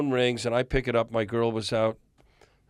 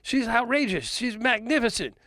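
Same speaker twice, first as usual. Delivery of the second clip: imitating [name]; 10 semitones higher than usual. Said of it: "He goes".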